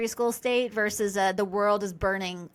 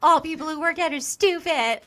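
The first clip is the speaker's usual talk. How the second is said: higher, a hint of a whiny teenage husband; mocking sulky teenager; 6 semitones higher, 3 dB louder than usual. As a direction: lightly parody his teenage sulk. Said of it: "that's so buddy".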